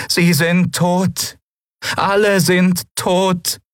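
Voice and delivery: high, very slow, melodic; clear flute-like voice. A narrator's reading. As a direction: let each word ring like a flute. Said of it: "eine klare Stimme, melodisch wie ein Flötenspiel".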